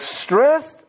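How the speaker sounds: "Stressed" pitched high with a lift at the end; "Stressed" said high and rising. Shown as a demonstration. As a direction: toss "Stressed" up high as a question. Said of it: "Everybody say that word".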